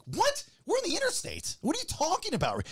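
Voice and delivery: very high-pitched retold disbelief; incredulous protest. Husband driving, told a woman eyed him, high disbelief. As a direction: shoot the pitch up, baffled and exasperated. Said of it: "And I'm like".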